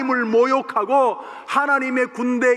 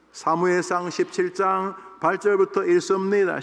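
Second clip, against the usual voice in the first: lower, even Bible reading voice; scripture reading; 5 semitones lower than usual. Acted. Pastor reading Samuel to the congregation, solemn reading. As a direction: read the verses steadily and solemnly.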